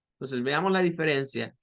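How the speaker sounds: ordinary delivery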